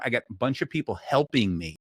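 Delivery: usual speaking voice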